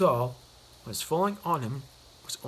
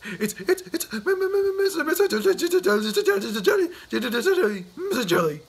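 high, louder, stuttering in fright; scared stammer; 10 semitones higher, 5 dB louder than usual. Acted. Mister Jelly stammering his name to the tramp, terrified and high. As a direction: trip over your own name in panic. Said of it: "scared Mister Jelly".

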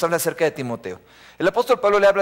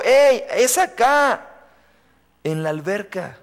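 put-on high, slow child calling out; a kid yelling to point the way; child's shout; 5 semitones higher, slower than usual. Preacher voices the boy shouting where the drowning man is. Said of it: "un niño gritó".

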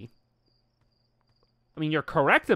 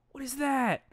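normal talking voice

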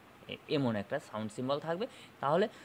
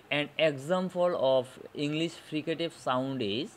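read out slowly; much slower than usual. Shown as a demonstration. Teacher reading the exam question aloud to students, very slowly. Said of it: "কোশ্চেনটা ছিল".

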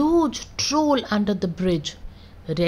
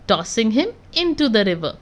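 ordinary delivery, nothing marked

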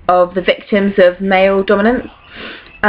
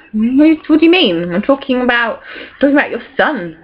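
mock indignant surprise; 5 semitones higher than usual. Clytemnestra retorting to her husband, mock indignant. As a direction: act put out, as if he's missed the obvious. Clytemnestra voiced answering Agamemnon.

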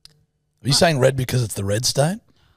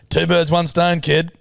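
ordinary talk, nothing marked

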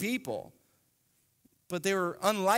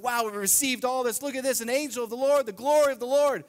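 high, loud, fast awed shepherd; excited shepherd; 6 semitones higher, 5 dB louder, faster than usual. Retold or imitated. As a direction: gush over the gifts. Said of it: "the shepherd's like".

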